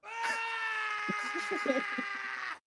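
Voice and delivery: high hammy dying wail; overacted death scene. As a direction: ham up a dramatic death cry. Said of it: "it's gonna be horrible acting".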